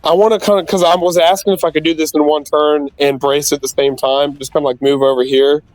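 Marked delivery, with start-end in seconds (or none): none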